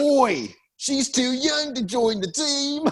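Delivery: high, slow cartoonish Australian voice; drawn-out, high-pitched mock Australian accent. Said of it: "Australian accent was a complete".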